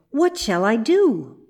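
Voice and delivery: plain reading voice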